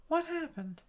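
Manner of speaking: WHAT pitched high and soft, falling on "happened"; puzzled question. Real, not acted. Crafter puzzled by a misbehaving computer, high soft "What happened?". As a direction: ask it high and quietly, baffled.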